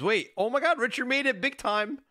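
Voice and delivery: ordinary talk, nothing marked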